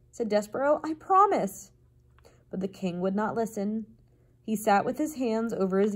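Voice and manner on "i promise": high, insistent little voice; pleading mouse. A narrator's reading. Mouse swearing honesty to the king, high earnest plea.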